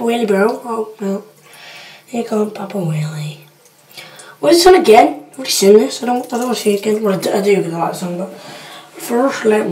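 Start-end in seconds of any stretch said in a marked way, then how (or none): 0.6-3.4 hushed, dreading whisper. Real, not acted. Vlogger bracing for Papa Wheelie's crash, whispering.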